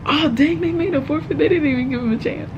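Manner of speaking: high-pitched, quick mock outrage; incredulous commentary; playful indignation. Real, not acted. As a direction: protest on someone's behalf, half joking.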